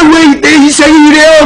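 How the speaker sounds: high, slow acted wail; lamenting. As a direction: draw it out as an aggrieved cry to heaven. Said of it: "이렇게 한탄할 때".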